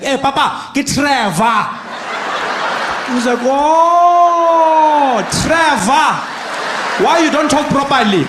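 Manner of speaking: loud, high mock-irritated shouting; hammed-up exasperated yell with a long drawn-out "Oh"; irritated friend. Irritated friend leaning over to correct him, hammed-up shout. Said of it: "my friend who was irritated, he just leans over me".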